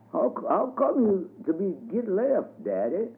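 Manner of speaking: put-on an old wagon driver calling to a friend; old man voice. A narrator's reading. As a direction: Uncle Bob asking Daddy Jake. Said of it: "asked Uncle Snakebit Bob".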